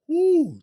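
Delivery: high loud whoop; excited woo. Real, not acted. Praising someone who took a hit, loud excited whoop. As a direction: let out a whoop of admiration. Celebrating toughness.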